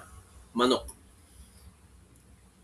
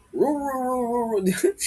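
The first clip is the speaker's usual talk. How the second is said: higher baying roof roof like a beagle; beagle bark; 7 semitones higher, much slower than usual. Shown as a demonstration. Teacher imitating how beagles bark, higher baying. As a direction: howl it like a beagle. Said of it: "Di ba ganun tumahol yung mga beagle".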